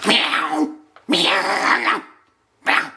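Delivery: harsh, yowling meows; spiteful cat. Retold or imitated. Man imitating the spiteful cat, harsh angry meows. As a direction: spit out nasty cat yowls. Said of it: "Then you get the spiteful cat".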